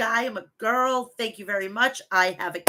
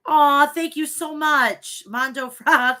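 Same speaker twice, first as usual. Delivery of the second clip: touched thanks; 5 semitones higher, 5 dB louder, slower than usual. Real, not acted. Touched by chat's kind message.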